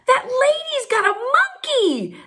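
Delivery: high, slow, breathless with delight; can't believe the monkey, tell everyone; thrilled announcement. Retold or imitated. Mom thrilled, sees a monkey.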